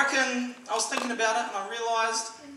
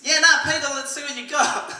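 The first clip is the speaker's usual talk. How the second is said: loud, high mock challenge; calling out a dare; 7 semitones higher, 11 dB louder than usual. Comic as Jesus daring Peter, calling out. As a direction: call it out, egging him on.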